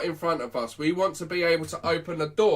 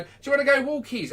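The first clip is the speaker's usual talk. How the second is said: higher-pitched walkies invitation; excited owner to dog; 9 semitones higher, 4 dB louder than usual. Acted. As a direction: rile the dog up for a walk. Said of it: "set the dog up for failure by going".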